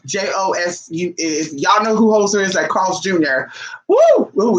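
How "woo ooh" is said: high, loud emotional "woo, ooh"; emotional whoop. Real, not acted. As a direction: let the feeling burst out in a high cry. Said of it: "it gets me a little emotional here".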